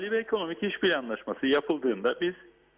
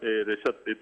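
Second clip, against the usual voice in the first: cave echo; 6 semitones lower than usual. Real, not acted. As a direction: voice echoing back on the line. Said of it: "bu arada ses yankılanıyor".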